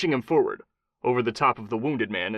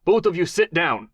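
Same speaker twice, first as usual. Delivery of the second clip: higher, louder fake Mexican accent; thick Mexican accent; 7 semitones higher, 5 dB louder than usual. A narrator's reading. Kai disguising himself with a fake Mexican accent, commanding. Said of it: "thick, false Mexican accent".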